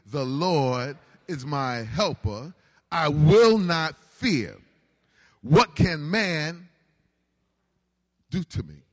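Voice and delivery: slow, deliberate, each word weighed; slow declaration. Shown as a demonstration. Pastor leading the congregation in the verse, slow and deliberate. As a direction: lead the congregation slowly through it. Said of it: "Can you say that with me?".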